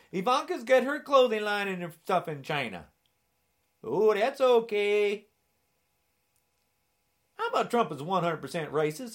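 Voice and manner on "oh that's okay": sarcastic. Acted. Sarcastic aside about China.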